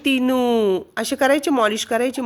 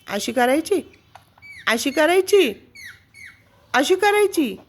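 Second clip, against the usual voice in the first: each अशी करायची? pitched higher, ending much higher; rising pet coo; 7 semitones higher than usual. Acted. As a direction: climb into a squeaky coo with each repeat. Coaxing bird during massage. (प्रत्येक वेळी अधिक उंच लाडिक आवाज)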